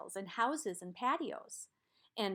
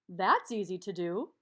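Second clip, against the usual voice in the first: louder, distinct shapeshifter voice; 3 semitones lower, 3 dB louder than usual. Acted. Shapeshifter welcomes the quadrilateral back, louder character voice. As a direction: greet the customer as the magical shapeshifter.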